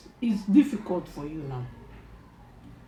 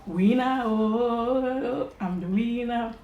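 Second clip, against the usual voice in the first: excited; 6 semitones higher, 5 dB louder, much slower than usual. Real, not acted. Finishing first, boasting.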